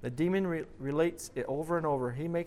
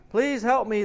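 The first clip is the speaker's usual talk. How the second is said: high, loud cry into the dark; shouting; 8 semitones higher, 9 dB louder than usual. A narrator's reading. The falling man shouting for help into the darkness, loud and high. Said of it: "the man shouts into the darkness".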